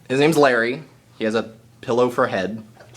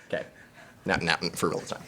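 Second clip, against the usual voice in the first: ordinary talk, nothing marked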